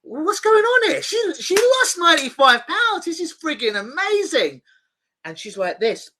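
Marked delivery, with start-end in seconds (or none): 0.0-4.6 high, loud, stunned disbelief; amazed inner thought. Retold or imitated. Hypnotist recalling his thrill at client's loss, high and excited. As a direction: gush like it's the best news ever. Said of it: "I'm thinking".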